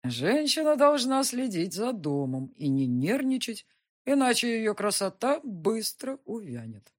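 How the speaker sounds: tipsy lecturing, words dragging; slurred cynical barfly. Acted. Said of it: "Похоже, он уже успел где-то напиться".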